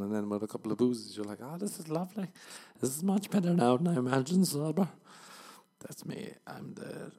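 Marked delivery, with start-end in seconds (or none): 1.4-4.9 put-on higher, louder drunk-sounding glee; delighted boozy voice; tipsy delight. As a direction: ham up a happy drunk. Drunk voice enjoying it.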